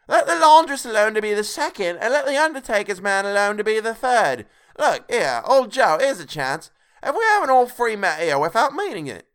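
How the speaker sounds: loud, high character voice; bold charwoman calling out. A narrator's reading. Charwoman greeting old Joe and her rivals, boldly calling out. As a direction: hail the room high and loud.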